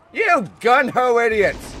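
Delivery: irritated commander's yell; exasperated scolding. Pretend. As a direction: berate the troops loudly. Berating his game soldiers.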